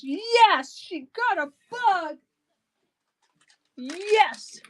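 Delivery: light, high mock cheer of a buyer; happy buyer voice. Seller voicing the happy buyer who won the bug, light high mock cheer. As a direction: squeak a winner's delighted yes. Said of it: "she says".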